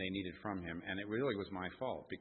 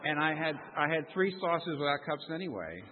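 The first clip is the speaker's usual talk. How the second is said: louder, higher, with a laugh; laughing retold; 7 semitones higher, 8 dB louder than usual. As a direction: laugh while quoting the wife. Wife retold, laughing, loud.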